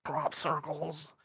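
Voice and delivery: lampoon a rambling chain-smoking guest; mocking Linda Moulton Howe. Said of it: "talking like this".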